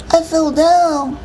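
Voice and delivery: put-on high, slow small-child voice; little boy. A narrator's reading. Little brother backs up the excuse to Mom, childlike. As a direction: echo it slowly in a tiny boy's voice.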